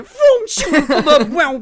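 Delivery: excited sound-effect mimicry; zoom sound effects. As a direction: blast the whoosh noises. Imitating edit sound effects.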